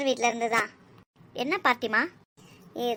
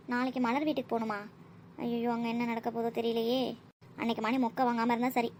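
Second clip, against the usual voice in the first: muttering to self; 2 semitones lower, 8 dB quieter than usual. Acted. Child dreading tomorrow's visit to Malar's house, muttering. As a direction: think aloud under your breath. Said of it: "என்ன புலம்பிட்டு இருக்க?".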